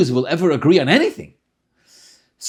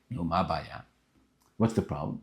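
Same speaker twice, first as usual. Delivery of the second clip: soft, lower, kindly; a hint of a soft-spoken Rebbe; gentle Rebbe; 8 semitones lower, 10 dB quieter than usual. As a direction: coax him like a patient rabbi. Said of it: "Rebbe asks again".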